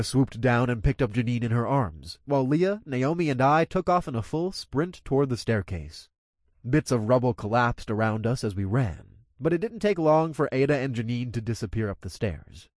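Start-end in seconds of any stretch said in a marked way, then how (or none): none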